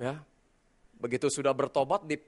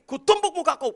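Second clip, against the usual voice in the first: loud, high fist-raised threat; threatening shout; 12 semitones higher, 9 dB louder than usual. Retold or imitated. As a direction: threaten to punch him. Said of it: "penampakan wajah Bapak ini". (ancaman marah dengan teriakan)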